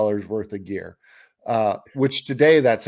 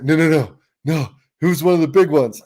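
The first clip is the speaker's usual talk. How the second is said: higher, insistent denial; retold protest; 4 semitones higher, 5 dB louder, faster than usual. Friend defending his lost fish, mildly insistent. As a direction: push back quickly at the teasing, pitched up.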